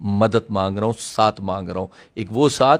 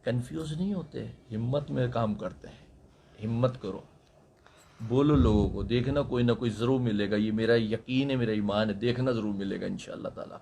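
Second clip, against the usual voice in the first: gentle encouragement; 3 semitones lower, 8 dB quieter than usual. Real, not acted. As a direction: coax her quietly with faith. Gently encourages the lost girl.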